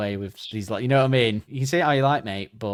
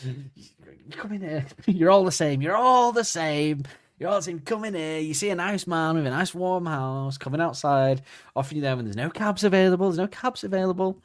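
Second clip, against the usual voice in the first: mock rant; 6 semitones higher than usual. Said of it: "angry now".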